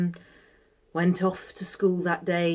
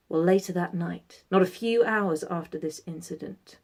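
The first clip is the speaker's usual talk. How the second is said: plain reading voice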